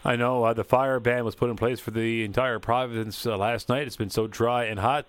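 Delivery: ordinary talk, nothing marked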